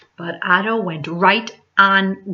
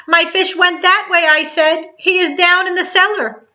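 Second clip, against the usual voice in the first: put-on much higher excited child voice; urgent, breathless news; boy hurrying the policeman to the cellar, much higher; 11 semitones higher than usual. A narrator's reading. Boy tells policeman, agitated.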